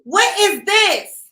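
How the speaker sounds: throwing the question back at him in disbelief; retold outraged yell. Retelling the dick tea story to viewers, a loud mock outraged yell. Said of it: "I said".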